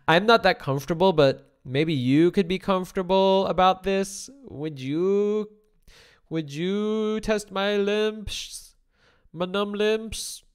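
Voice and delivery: unsure pleading. Retold or imitated. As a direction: ask timidly, almost begging. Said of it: "he was already pleading with her".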